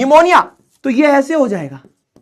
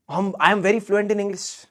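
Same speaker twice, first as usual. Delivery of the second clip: slow, soft smug imitation; mock boasting; 2 semitones lower, 7 dB quieter, much slower than usual. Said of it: "सामने वाले ने पहले ही बोला". (धीमी, नकली शेखी)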